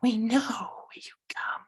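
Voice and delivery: high eerie whisper; fortune teller. Acted. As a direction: whisper like a seer who knows all. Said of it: "in their creepy voice".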